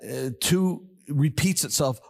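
ordinary talk, nothing marked

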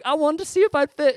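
put-on high, loud little-boy excuse; a child explaining himself to dad; imitating his young self, high; sheepish kid. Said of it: "I was like".

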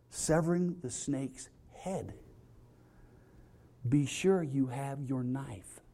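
slow, hushed and deadpan, pausing between commands; mock-serious instruction reading. Pastor reading anaconda survival steps to the congregation, slow, hushed, mock-grave.

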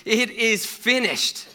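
high, loud, slow final cry. Acted. Jesus on the cross yells out with his last breath, loud and slow. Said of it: "he yells out, as Mark says, in a loud voice".

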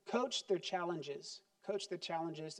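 soft emphatic repeat. Shown as a demonstration. Preacher repeating the key point to parents, soft and slow. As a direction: let the point settle softly.

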